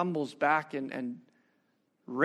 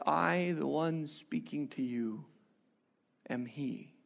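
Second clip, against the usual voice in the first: low, slow and hushed; soft solemn reveal; 5 semitones lower, 7 dB quieter, slower than usual. Acted. Jesus reveals himself to the woman, slow and hushed. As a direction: let it fall quietly, slowly.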